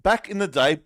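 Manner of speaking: ordinary talk, nothing marked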